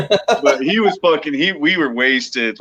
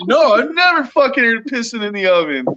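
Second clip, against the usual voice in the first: incredulous; 9 semitones higher, faster than usual. Retold or imitated. Retold incredulous reply, high.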